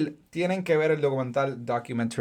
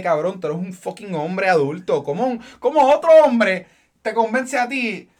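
mock scolding; 5 semitones higher, 4 dB louder than usual.

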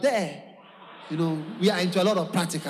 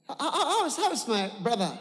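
nervous laughing greeting; 4 semitones higher than usual. Acted. Acting nervous son with laugh.

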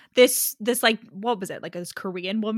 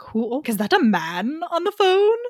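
higher, swooping surprised question; mock astonished mom; 5 semitones higher, 4 dB louder than usual. Mom overhearing a man on the phone, mock astonished. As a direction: imitate a mom's scandalized surprise, pitch climbing.

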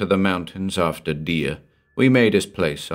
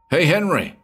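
shouted call; 10 semitones higher than usual. Acted. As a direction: shout his name up the house. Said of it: "I shouted".

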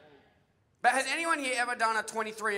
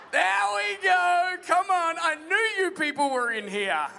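triumphant teasing shout; 7 semitones higher, 6 dB louder than usual. Real, not acted. Preacher to congregation as hands go up, triumphant teasing shout. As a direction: crow it out, high and loud.

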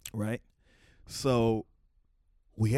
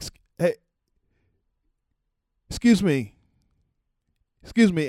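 calling out politely; 4 semitones higher, 5 dB louder than usual. Retold or imitated. Calling to stars in the way.

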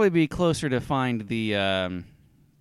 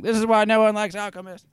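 angry customer; 7 semitones higher, 3 dB louder than usual. Acted. Human curses and yells.

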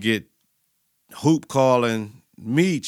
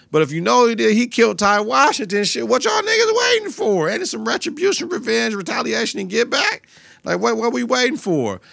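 outraged disbelief; 10 semitones higher than usual. Real, not acted. Vlogger urging the crew to get revenge, high outraged cry. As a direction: demand it, can't believe they're waiting.

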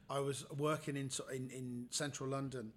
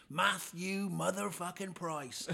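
slow, swaggering louder greeting; cocky bully; 5 semitones higher, 6 dB louder, slower than usual. Retold or imitated. School bully greeting him on the road, cocky and loud. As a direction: drag out the name like a cocky bloke. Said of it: "and he goes".

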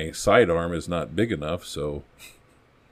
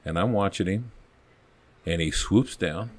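normal talking voice

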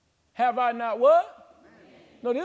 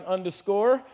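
ordinary talk

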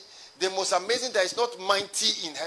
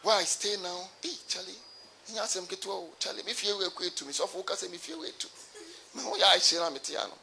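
mumbled inner grumble; 10 dB quieter than usual. Pretend. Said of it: "people who are thinking".

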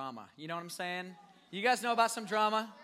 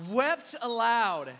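Wept aloud said slowly and louder; stress on wept; 4 semitones higher, 8 dB louder, much slower than usual. Shown as a demonstration. Preacher showing the drama, slow and loud. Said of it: "This is what they did".

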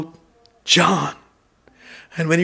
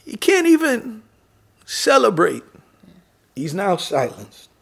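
ordinary talk, nothing marked

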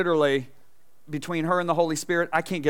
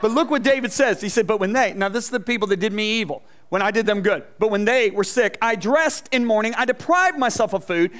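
raised, louder preaching voice; 5 semitones higher, 5 dB louder than usual. Acted. Pastor preaching the psalm to his congregation. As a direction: build the verse up for the congregation.

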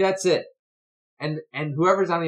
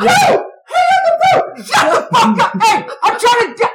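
very high, loud screamed outburst; descending into rage; 11 dB louder than usual. Retold or imitated. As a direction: yell furiously down the phone as an exasperated dad. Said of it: "this is how it descends".

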